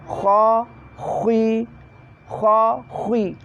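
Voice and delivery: "khui" from the upper throat, lips forward; rounded KH demo. Shown as a demonstration. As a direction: say "kho, khui" keeping the lips pushed out. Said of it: "ketika mengucapkan kasroh pada posisi kho tetap". (lafal "khui" dengan mulut moncong)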